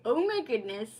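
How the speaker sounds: ordinary delivery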